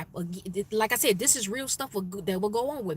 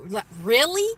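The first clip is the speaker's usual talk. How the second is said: louder, disbelieving retort; incredulous; 3 semitones higher, 6 dB louder than usual. Retold or imitated. Employee reacting to a silly turban complaint, incredulous. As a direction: act out "you can't be serious".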